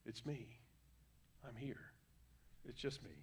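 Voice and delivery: whispered reassurance. Acted. Jesus whispering to someone in distress, gentle whisper. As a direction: breathe it softly, close. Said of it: "Jesus just goes".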